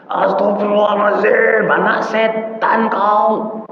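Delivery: high, slow mock scold of a furious mother; angry mother scolding. Mother cursing her child, mock angry shriek. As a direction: shriek it while pretending to pray. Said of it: "pakai astagfirullah sambil marah".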